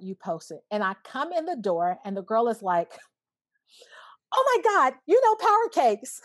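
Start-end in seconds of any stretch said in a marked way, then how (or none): 4.3-6.2 high, loud mock fangirl gushing; starstruck squeal. Guest imitating salad-shop girl recognising her friend, starstruck. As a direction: imitate a thrilled fan, pitched way up.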